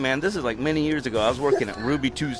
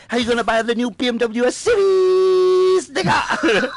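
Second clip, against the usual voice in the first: high mock-angry scolding in a Japanese accent, building to a shout; mad Japanese dad; 8 semitones higher, 3 dB louder than usual. Dad scolds daughter over bad grades, hammed-up angry Japanese accent. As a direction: imitate an irate father's rant in a Japanese accent, louder and louder. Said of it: "walks up to her all mad".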